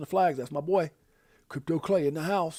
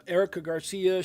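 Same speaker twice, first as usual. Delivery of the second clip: normal talking voice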